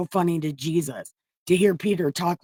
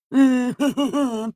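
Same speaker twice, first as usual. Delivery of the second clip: laugh; 6 semitones higher than usual. Real, not acted. Laughs mid Peter impression.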